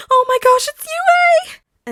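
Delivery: put-on very high, loud, thrilled child squeal; excited shriek. A narrator's reading. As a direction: squeal with delight like a starstruck kid. Excited child at hero school.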